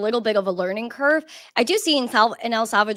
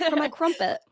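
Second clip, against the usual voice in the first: higher-pitched mock English accent; 7 semitones higher than usual. Guest adding to her British line, English accent. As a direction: play up an English accent for a joke. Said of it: "that English accent there".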